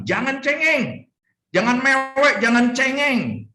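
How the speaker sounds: high, slow teasing rebuke; mocking scold. God telling Job not to whine, teasing high scold. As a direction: chide him like a whining child.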